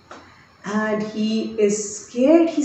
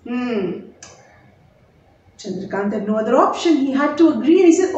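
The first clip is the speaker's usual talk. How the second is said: ordinary delivery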